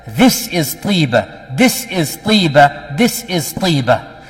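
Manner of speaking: slow, weighty repetition of "This is Tiba"; emphatic. Retold or imitated. Prophet poking on the mimbar as he tells listeners, emphatic. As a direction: hammer out each "This is Tiba" slowly, punching THIS. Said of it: "like this to emphasize".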